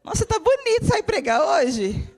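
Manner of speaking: high, exaggerated mock admirer; mimicking a member. Mimicking a church member's remark.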